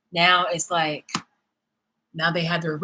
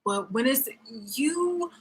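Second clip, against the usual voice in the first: usual speaking voice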